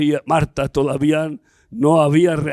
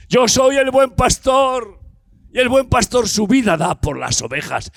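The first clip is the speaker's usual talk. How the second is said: fiery preaching; 7 semitones higher than usual. Acted. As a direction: thunder each "Yo soy" to the congregation. Preacher proclaiming Jesus' words.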